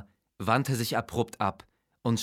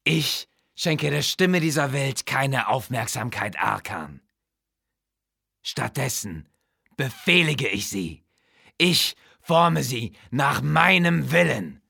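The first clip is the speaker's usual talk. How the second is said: menacing necromancer king; 5 semitones higher, 5 dB louder than usual. Acted. Necromancer king scolds his weak-willed vizier, lightly voiced contempt. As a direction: lay down your power, cold and proud.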